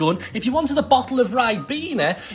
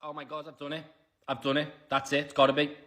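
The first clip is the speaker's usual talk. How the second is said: low, very soft and disbelieving; hushed anticipation; 8 semitones lower, 12 dB quieter, faster than usual. Pretend. Player sure they have done it, hushed anticipation. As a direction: barely dare say it, under the breath. Hushed hope before the result.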